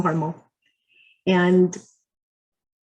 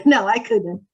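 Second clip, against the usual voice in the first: normal talking voice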